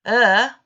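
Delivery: "er" held as a long, loud er like in heard; long vowel er. Shown as a demonstration. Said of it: "In the long vowel sounds".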